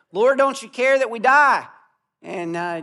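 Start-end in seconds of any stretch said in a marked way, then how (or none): 0.1-1.7 higher and louder, frightened plea; panicked cry. Retold or imitated. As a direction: cry out as if the boat is sinking. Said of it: "They woke him up and they said".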